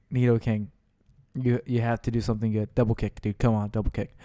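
ordinary delivery

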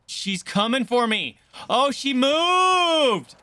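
slow, high, loud terrified shriek; real fright as the monster moves; panicked scream. Real, not acted. Jump-scare in horror game.

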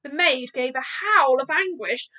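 ordinary delivery, nothing marked

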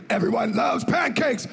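loud mock punchline. [name] shouting Mooney's joke to the memorial crowd, loud mock punchline. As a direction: bellow it to the room.

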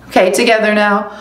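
ordinary delivery

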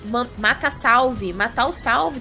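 usual speaking voice

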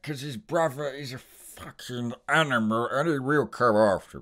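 loud Cockney gangster punchline; Alfie Solomons setup and punchline. Retold or imitated. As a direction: build it up, land the insult. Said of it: "talks in this setup, punchline, kind of manner".

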